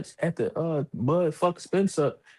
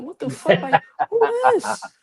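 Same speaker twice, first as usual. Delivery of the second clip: much higher, louder squeaky "who this?"; mock surprise; 13 semitones higher, 6 dB louder than usual. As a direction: sound baffled and caught off guard. Kid handed phone, confused.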